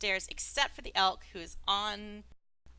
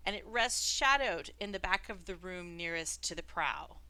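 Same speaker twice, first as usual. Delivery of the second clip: measured, slightly lowered scene-setting; game master narration; 5 semitones lower, 4 dB quieter than usual. A narrator's reading. Game master describing a webbed room to Pants, measured narration. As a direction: describe the room deliberately, phrase by phrase.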